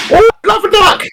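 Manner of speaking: high, squeaky Cockney; hammed-up Cockney mum; Cockney accent. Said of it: "a Cockney accent".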